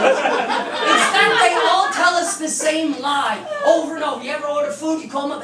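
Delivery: usual speaking voice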